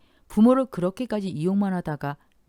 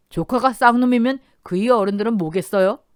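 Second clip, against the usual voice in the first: cold cutting reply, louder; cold retort; 5 semitones higher, 9 dB louder than usual. Acted. Eldest son coldly rebuking his uncle, cold retort. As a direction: answer with icy contempt. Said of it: "차갑게 말했습니다".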